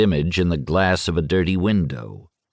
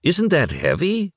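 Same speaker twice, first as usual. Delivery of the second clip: much higher, a touch unsure; awkward question; 11 semitones higher than usual. A narrator's reading. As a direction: ask it a little awkwardly. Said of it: "feeling awkward".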